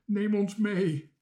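high, loud, drawn-out plea; imploring request. A narrator's reading. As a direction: voice the animals begging to come along. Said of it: "Alsof ze vroegen".

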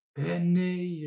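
high, loud, drawn-out objection; protesting cry. A narrator's reading. Marinka objects to freeing the animals, calling out. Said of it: "riep Marinka".

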